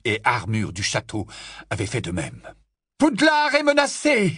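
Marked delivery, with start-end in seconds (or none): 3.0-4.3 high-pitched rallying cry; yelling. A narrator's reading. Professor warning the statues of danger, yelling. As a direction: raise the alarm at full pitch. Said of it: "hurla le professeur McGonagall".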